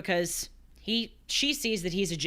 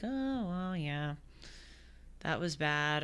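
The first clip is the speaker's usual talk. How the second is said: soft, half-hearted mock reaction; lukewarm shrug; 7 dB quieter, slower than usual. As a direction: toss it off like it barely matters. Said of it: "there's this kind of like".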